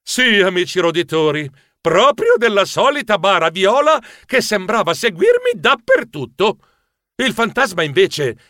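ordinary delivery

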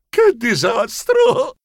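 high, slow cry of dismay; despairing wail. Acted. Director seeing nobody working, high despairing lament. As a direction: wail it out, stretching the words.

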